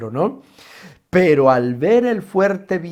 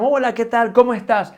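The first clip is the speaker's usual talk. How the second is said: high, cheery hello; a friend asking how you are, sing-song and higher; bright greeting; 8 semitones higher, 4 dB louder than usual. Acted.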